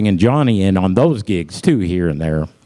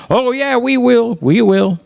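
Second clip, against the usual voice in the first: eager promise; 11 semitones higher than usual. Retold or imitated. Frat guys promising the dad.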